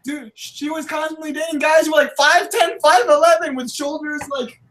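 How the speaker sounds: high exasperated protest. Real, not acted. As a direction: protest in disbelief.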